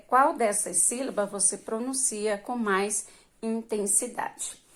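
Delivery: usual speaking voice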